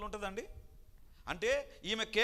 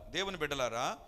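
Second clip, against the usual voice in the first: usual speaking voice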